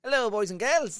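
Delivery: high, loud kiddie-show greeting; playful host voice. Pretend.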